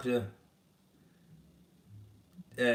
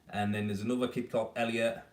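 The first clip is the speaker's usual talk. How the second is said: ordinary delivery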